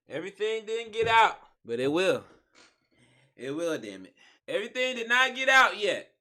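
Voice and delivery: high loud exasperated complaint; frustrated outburst. Real, not acted. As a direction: vent it loudly after the bathroom. Back from the toilet, frustrated.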